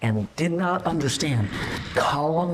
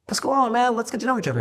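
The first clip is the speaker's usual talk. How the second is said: friendly greeting; 8 semitones higher, faster than usual. Retold or imitated. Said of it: "I said".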